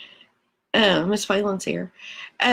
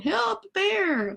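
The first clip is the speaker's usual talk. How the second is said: high, hammed-up cry for help; mock camper scream; 12 semitones higher, 3 dB quieter than usual. Campers fleeing a grizzly, high mock cry. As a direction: squeal like a scared camper. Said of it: "makes the campers scream".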